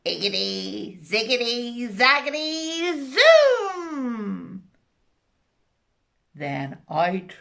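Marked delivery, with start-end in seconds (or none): 0.0-4.7 witch's spell. Acted. Witch casting over the cauldron, building spell chant. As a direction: chant it rising to a burst. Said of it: "she muttered a spell".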